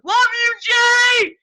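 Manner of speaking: high, loud fan shout; calling out. Retold or imitated. Fan calls to the drummer while walking away, shouting. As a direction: yell it back over your shoulder. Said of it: "when I turned back, I was like".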